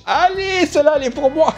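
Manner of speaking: high, strained call; high-pitched mock battle cry; hammed-up rallying cry. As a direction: call it out high like you're claiming it. Acts lit firework going off.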